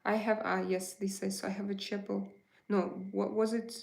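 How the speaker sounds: ordinary talk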